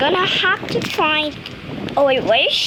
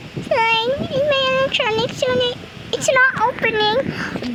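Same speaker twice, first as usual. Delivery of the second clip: put-on whiny girl doll; 9 semitones higher than usual. Doll girl complains about power.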